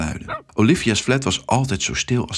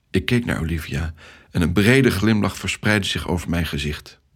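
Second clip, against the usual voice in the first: usual speaking voice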